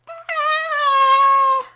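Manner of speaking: high, loud goofy squeals; be playfully goofy with the kids; silly. Pretend. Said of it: "Silly-ish".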